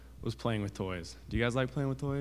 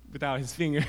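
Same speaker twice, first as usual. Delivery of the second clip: amused storytelling breaking into a laugh; 3 semitones higher, 3 dB quieter than usual. Real, not acted. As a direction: let a laugh spill out at the end. Storyteller laughs at own grisly line.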